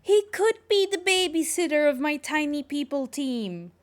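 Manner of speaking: excited. Real, not acted. Streamer excited about idea.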